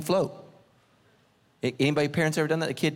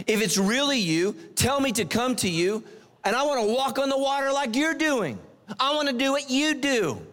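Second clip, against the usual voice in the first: eager Peter; 9 semitones higher than usual. Acted. Peter asking Jesus, pleading.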